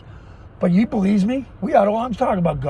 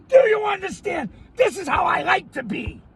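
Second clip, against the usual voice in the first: happy shouting; 9 semitones higher than usual. Real, not acted. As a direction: bellow it, this is you content. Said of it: "this is me happy".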